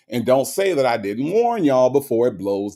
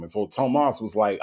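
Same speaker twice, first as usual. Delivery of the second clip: ordinary delivery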